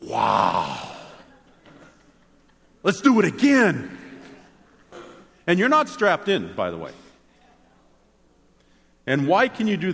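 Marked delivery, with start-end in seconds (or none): 0.0-4.0 thrilled rider. Retold or imitated. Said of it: "you know what you say?".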